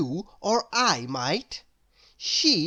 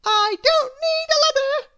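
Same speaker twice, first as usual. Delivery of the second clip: put-on very high, bright and louder little girl; Little Miss Somersault; 7 dB louder than usual. A narrator's reading. Little Miss Somersault brushing off Mister Worry, very high and loud. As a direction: chirp it way up high. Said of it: "Little Miss Somersault said".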